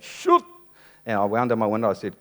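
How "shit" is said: high yelped curse; high startled yelp; panicked shout. Retold or imitated. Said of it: "and I was like".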